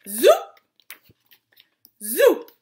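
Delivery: loud zoop swooping upward; two big rising wand zaps; magic wand zoop. Acted. Father making the giant vanish, rising zoop.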